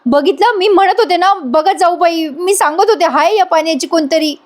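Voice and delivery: high-pitched imitated outcry, no real shout; mock yelling. Payal storms in on everyone, mock yelling. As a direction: cry out "I told you so" in pretend outrage. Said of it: "पायल येते ओरडत ओरडत".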